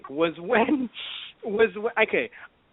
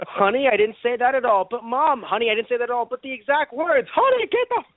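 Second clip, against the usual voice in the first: fake-sweet then furious, high; scolding mom; 6 semitones higher than usual. Bulma scolding Trunks for repeating her, high mock scold.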